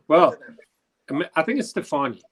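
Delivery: usual speaking voice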